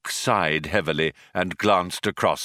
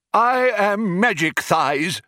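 slow, high-pitched proclamation; grand announcement; 11 semitones higher, 3 dB louder, slower than usual. A narrator's reading. Older intruder introduces himself to programmers, grand announcement. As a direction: announce yourself with ceremony. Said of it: "announced the older one".

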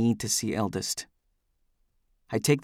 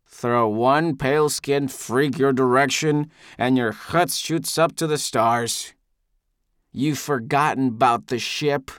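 mildly angry, drawn-out tirade; Eldest; slower than usual. A narrator's reading. Drunk Eldest berating his accuser, scornful. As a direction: berate him slowly and scornfully.

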